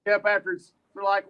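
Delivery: usual speaking voice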